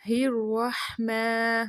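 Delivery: الرحمن with a throaty ح and heavy ر; slow الرحمن from mid-throat; clear haa. Shown as a demonstration. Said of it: "ہا کو ہا نہیں پڑھنا". (الرحمن میں حلقی حا)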